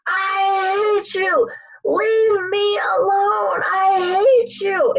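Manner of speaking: drawn-out, high mock scream; high-pitched imitation of screaming, every word stretched; imitated scream. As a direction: wail it slowly as an upset mother. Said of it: "screams at the top of her lungs".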